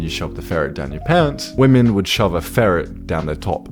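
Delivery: ordinary delivery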